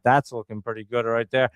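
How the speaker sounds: ordinary delivery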